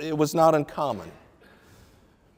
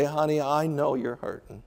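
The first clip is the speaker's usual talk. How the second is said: gentle husband. Pretend. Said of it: "for a godly husband to say".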